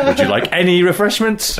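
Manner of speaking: any stressed, in a high voice. Retold or imitated. Imitating train trolley man, stress on any. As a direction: punch the word any, pitched high. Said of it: "moving the emphasis down the sentence".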